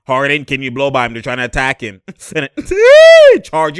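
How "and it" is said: a very high, loud laughing shriek. Real, not acted. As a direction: scream with laughter. Reacts to charge call.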